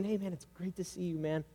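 soft, gentle greeting; warm welcome. Acted. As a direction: welcome them back without judgment. Acted welcome of returning believer.

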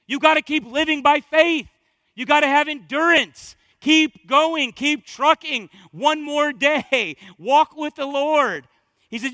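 loud, high, punched-out exhortation; full-force preaching. Real, not acted. Preacher urging believers to live by faith, at full force. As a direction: belt out each short charge to the crowd.

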